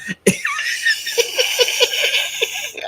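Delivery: long, high, wheezy giggle; helpless laughter. Real, not acted. Vlogger recalling a rude joke, laughing helplessly. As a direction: crack up in a soft, squealing laugh. Cracks up at her story.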